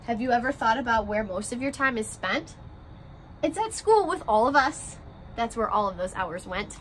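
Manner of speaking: gentle picture-book read-aloud, rhymes bouncing along; reading to children. A narrator's reading. Teacher reading a class picture book to her first graders, story-time voice.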